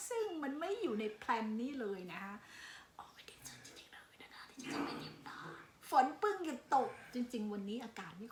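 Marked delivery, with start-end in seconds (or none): 3.0-5.4 whispered delight; excited whisper. Real, not acted. Telling viewers her joy about the forest in a whisper. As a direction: breathe it out as a secret thrill.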